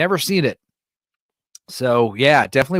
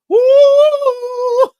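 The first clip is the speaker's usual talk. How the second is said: high woo held back in volume; pulled-back high note; 4 dB louder than usual. Shown as a demonstration. Streamer shows how he sings the high note, pulled back. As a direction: hit the note but hold back. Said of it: "I literally gotta, I gotta pull all the way back".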